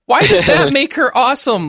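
laughing disbelief, pitch jumping up; incredulous. Real, not acted. Friend questions why bisexuality makes her awesome, laughing disbelief.

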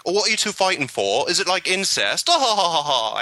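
playful mock hero line with a forced laugh; joking Hawke. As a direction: tease them like a wisecracking hero, then cackle. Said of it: "and Hawk will be like".

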